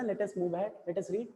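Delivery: ordinary delivery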